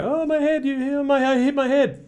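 squeaky, very high mock toddler wail; imitated crying child. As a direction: play a little kid wailing over a bump. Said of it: "they're crying and they're genuinely upset".

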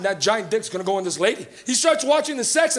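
ordinary delivery

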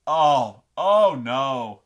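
loud, mock-dismayed wail; imagined author aghast at the news; mock distraught. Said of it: "He'd go so nuts".

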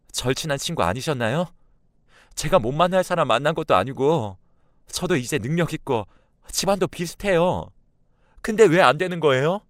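put-on high-pitched young daughter arguing back; upset, pleading confrontation; indignant protest. A narrator's reading. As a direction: build from firm objection to loud, high demand. Daughter confronts mother's angry friend.

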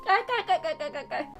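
spoken mock giggle, kkalkkal. Teasing about Dolsoe, a playful mock giggle.

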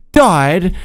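loud. Real, not acted. Loud story line.

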